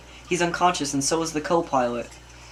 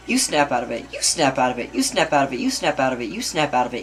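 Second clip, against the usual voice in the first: low, steady repetitive chant; repeated retort; 4 semitones lower than usual. Acted. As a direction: fire the same retort back over and over. Repeated retort in panicked quarrel.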